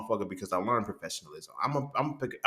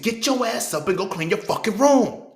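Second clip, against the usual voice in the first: much higher, louder and slow angry bark; parent yelling; 13 semitones higher, 8 dB louder than usual. Retold or imitated. Parent yells at child to clean the room, furious shout. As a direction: blow up like a fed-up parent.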